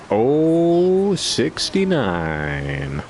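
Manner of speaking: slow drawn-out bingo call. Retold or imitated. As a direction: stretch it out like a crowd yelling. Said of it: "everybody was supposed to yell out".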